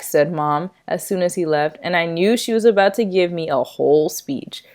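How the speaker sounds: ordinary delivery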